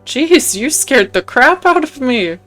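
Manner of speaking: jolted and exasperated; startled. Acted. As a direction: blurt it out after a scare. Acts startled heroine.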